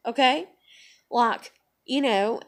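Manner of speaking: ordinary talk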